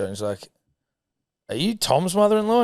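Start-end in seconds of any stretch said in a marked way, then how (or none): 1.5-2.6 high amazed ask; dawning surprise, much higher; surprised question. Retold or imitated. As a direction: bloke suddenly realising. Stranger realises.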